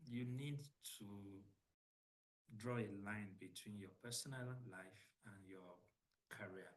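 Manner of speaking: faint, far from the mic; very low, distant voice; barely audible. Real, not acted. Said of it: "they said my voice is very low".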